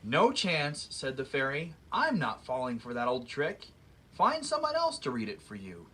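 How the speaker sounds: lively read-aloud storybook voice; story narration. A narrator's reading. Reading a fairy tale to children, lively storyteller voice. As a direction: read it like story time for kids.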